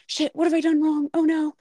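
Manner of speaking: high, loud panicked self-questioning; hammed-up "what have I done" panic; mock panic. Acts out stylist's alarmed inner reaction.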